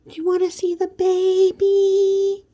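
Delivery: put-on drawn-out sing-song baby question; cooing parent mock. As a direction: show off the sleeping newborn, high and slow. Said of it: "And they're like".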